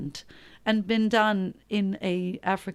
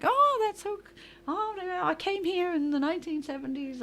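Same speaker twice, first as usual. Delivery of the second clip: higher-pitched, lightly voiced chatty lady; gushing proprietor; 9 semitones higher, 3 dB quieter than usual. American proprietor greeting fellow countrywomen, lightly mimicked high gush.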